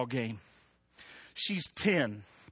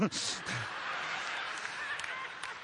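breathy laugh; 13 dB quieter than usual. Real, not acted. Laugh after punchline.

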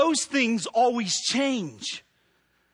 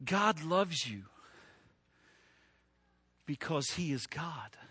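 hushed, solemn; 5 semitones lower, 10 dB quieter than usual. Real, not acted. Quiet climax after long pause.

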